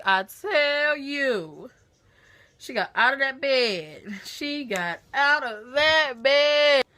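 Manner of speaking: loud, drawn-out, dramatic storytelling emphasis; loud, stretched-out exclamation; emphatic exclamation. Real, not acted. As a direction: belt it out for comic effect. Dramatic retelling of aunt's rush.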